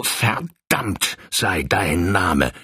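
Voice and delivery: slow, venomous curse; seething. Acted. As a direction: spit the curse through pain. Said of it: "schäumte der Colonel".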